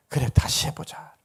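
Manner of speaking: whispered encouragement; 속삭이는 격려. Acted. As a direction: hush it into a gentle, breath-only urging. 격려 끝을 속삭이듯 맺음. (속삭이듯 다독이며)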